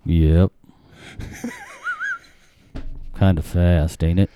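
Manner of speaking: low, laconic country dad; her dad's voice. Retold or imitated. Dad answering a marriage request by phone, laconic. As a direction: drop low and spare with the words. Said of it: "His accent when he does my dad's voice".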